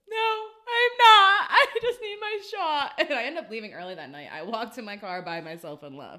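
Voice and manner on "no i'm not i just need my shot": high, loud, slow hammed-up sob; mock sobbing. She tells a coworker she's not okay, hammed-up sobbing. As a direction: wail it like a drunk crybaby. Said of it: "retelling her drunk crying answer".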